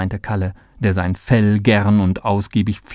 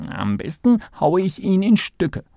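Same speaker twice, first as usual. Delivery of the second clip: mole's thought; 9 semitones higher than usual. A narrator's reading. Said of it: "dachte Kalle".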